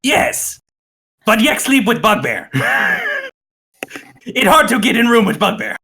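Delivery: very high goblin squeal; high, comic goblin objection. Acted. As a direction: protest as a high-voiced goblin. Goblin answers the player.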